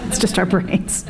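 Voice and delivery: breathy laughing mock-smug aside; soft, laughing mockery of smug pride. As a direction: laugh through it, mocking the smugness. Mocking smug liberals.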